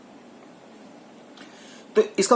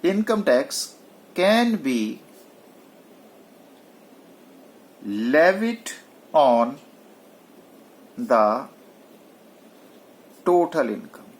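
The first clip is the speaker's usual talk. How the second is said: slow dictation; 3 semitones lower, much slower than usual. Shown as a demonstration. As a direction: answer dictated with long pauses. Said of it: "इसका answer क्या होगा?".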